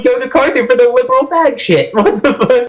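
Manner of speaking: amused disbelief. Retold or imitated. Guest quoting the host's slight back at him, amused disbelief. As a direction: repeat it in laughing disbelief.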